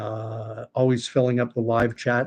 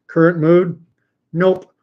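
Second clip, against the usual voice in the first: mock grumpy; 6 semitones higher, 8 dB louder than usual. Reading his coffee mug aloud.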